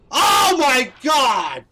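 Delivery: high, loud, slow despairing cry; drawn-out shout. Real, not acted. Gamer crying out as his climber falls again. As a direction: wail it out long. Reacting to a fall.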